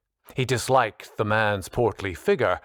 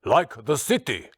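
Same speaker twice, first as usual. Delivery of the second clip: higher, mildly exasperated Austrian growl; 6 semitones higher than usual. A narrator's reading. Austrian teacher explaining his name to colleague, lightly growled. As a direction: insist in an irritated Austrian accent. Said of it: "Hans growled".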